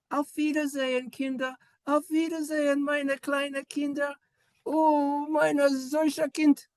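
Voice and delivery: high, cooing German voice; German farewell role-play. Pretend. German parent bidding little children farewell, high cooing voice. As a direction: coo a pretend goodbye in high-pitched German.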